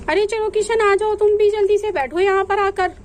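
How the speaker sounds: loud, high call across the room; calling out loudly. Acted. Sister hurries brother Kishan to sit, calling loudly. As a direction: summon Kishan over, full voice. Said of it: "आप सब पे ही चिल्ला रही हैं।".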